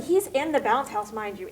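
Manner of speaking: usual speaking voice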